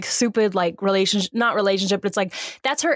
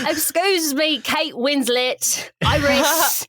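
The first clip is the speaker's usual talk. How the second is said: higher, drawn-out mock English accent; 4 semitones higher, much slower than usual. Friend corrects herself to address Iris, hammed-up English accent. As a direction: put on a hammed-up English accent, stretching the name. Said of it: "We're in England".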